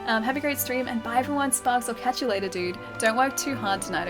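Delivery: ordinary delivery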